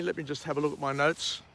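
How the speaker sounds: usual speaking voice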